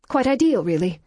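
high, dry ironic quip; sarcastic. A narrator's reading. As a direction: say it with a wry sneer. Sarcastic narration of wallflower fate.

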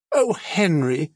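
high, imploring call to a husband; pleading cry. A narrator's reading. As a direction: cry out in mild dismay. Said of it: "she cried".

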